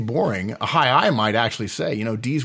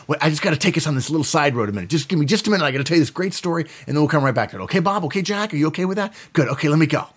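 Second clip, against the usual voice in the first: fast, higher-pitched eager tangent; chatty high I; 5 semitones higher, much faster than usual. Acted. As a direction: rush through it, checking in with each listener. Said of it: "They love to go on tangents".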